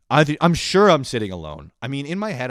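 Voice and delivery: ordinary talk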